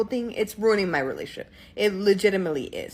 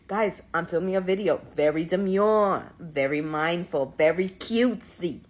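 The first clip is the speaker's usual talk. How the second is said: weird New York-like accent; 4 semitones lower than usual. Shown as a demonstration. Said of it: "Let me give you a little taste".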